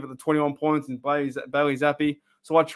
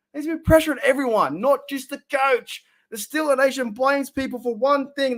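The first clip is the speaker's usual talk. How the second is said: frustrated rant; 8 semitones higher than usual. Real, not acted. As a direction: pitch far higher, heated and insistent. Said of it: "very frustrated".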